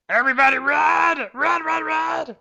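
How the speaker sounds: high urgent yell to run; shouting. Pretend. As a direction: bark the retreat order loudly. Yelling orders at party.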